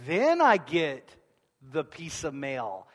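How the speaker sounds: high, loud, driving sermon delivery; full-force preaching. Acted. Pastor pressing the point on his congregation, preaching loudly. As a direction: preach it hard to the back row.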